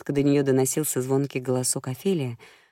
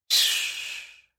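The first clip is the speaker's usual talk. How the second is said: шиканье. A narrator's reading. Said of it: "перебила Флори, прижав палец к губам".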